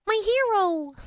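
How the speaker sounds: high, sing-song "my hero"; cooing "my hero". Shown as a demonstration. Owner cooing a pet name at her rescue dog, high and doting. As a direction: coo it up high like baby talk. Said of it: "I'd say it in that exact voice".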